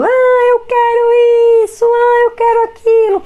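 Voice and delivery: put-on high, loud, drawn-out whine of a child begging; imitating whiny crying child. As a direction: mimic a little boy whining and crying for things. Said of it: "pedindo as coisas chorando".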